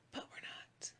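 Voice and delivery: whispered tease to the listeners; teasing whisper. Pretend. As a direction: whisper it as a sly letdown.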